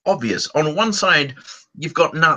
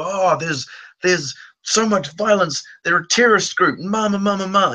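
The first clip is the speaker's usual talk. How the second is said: higher, whiny mock alarm; mocking right-wing panic; 7 semitones higher than usual. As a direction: parody the outrage, trailing into babble. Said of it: "from the right, you get".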